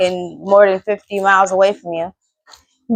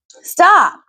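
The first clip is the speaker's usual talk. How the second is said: sharp stop command; 7 semitones higher than usual. Real, not acted. Telling thirsty strangers on Facebook to quit, a sharp high stop. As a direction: cut them off dead.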